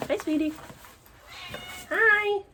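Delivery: very high-pitched pet talk; cooing to pet. Real, not acted. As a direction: exclaim to your pet way up high. Greeting her pet.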